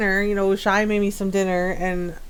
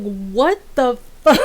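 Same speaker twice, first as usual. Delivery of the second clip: half-laughing mock outrage; mad. Streamer finding the settings were reset, mock mad. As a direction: retell your disbelief with a laugh breaking in. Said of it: "I'm so mad".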